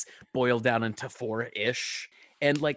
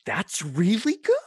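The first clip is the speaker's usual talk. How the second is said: drawn-out, rising, louder delight; surprised approval; 6 semitones higher, 5 dB louder, slower than usual. Acted. Podcast listener suddenly won over by the hosts, surprised approval. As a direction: say it slowly, pitched up in pleasant astonishment.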